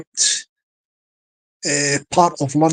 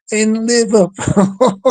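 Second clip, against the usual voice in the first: laughing; 3 semitones higher, slower than usual. Real, not acted. Laughing while saying Liverpool.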